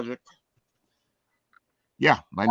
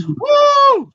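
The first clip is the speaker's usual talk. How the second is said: very high, loud cheering whoop; excited woo; 13 dB louder than usual. Real, not acted. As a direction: whoop like a hyped crowd. Host hyping next trailer.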